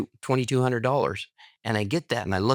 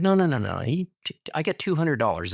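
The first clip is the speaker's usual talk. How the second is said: higher, quick protesting no's; lively mock protest to his wife's mother; eager refusal; 6 semitones higher than usual. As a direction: wave off the offer brightly. Refusing mother-in-law's extra twenty.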